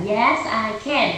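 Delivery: drawn-out, careful "Yes, I can"; slow model answer. Shown as a demonstration. Teacher repeating the model answer, very slow. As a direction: spell it out slowly for the child. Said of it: "Trả lời là".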